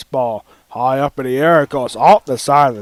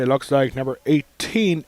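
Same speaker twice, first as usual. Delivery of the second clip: ordinary delivery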